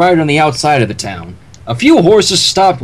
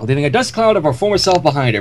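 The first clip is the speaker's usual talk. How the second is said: plain reading voice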